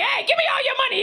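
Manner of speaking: high, urgent mock shout; acted robber's demand. Robber pulling up on a couple, mock shouting. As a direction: shout it like a stick-up. Acting a robber holding someone up.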